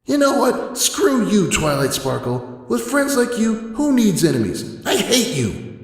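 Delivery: high, loud, venomous yelling; hateful shout. Acted. Dash screaming hatred at Twilight. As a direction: hurl it at her. Dash's final hateful outburst.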